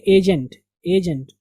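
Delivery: Agent drawn out slowly, each syllable clear; teacher slows agent for the class; slow careful pronunciation. Shown as a demonstration. Teacher to students, agent said slowly and clearly. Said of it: "जल्दी से नहीं पढ़ेंगे".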